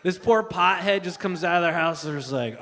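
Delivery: normal talking voice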